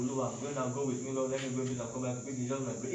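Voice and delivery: low, soft perfunctory praying; mock token prayer. Preacher mimics someone praying before going out, low mock-perfunctory prayer. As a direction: toss off a dutiful little prayer, mockingly.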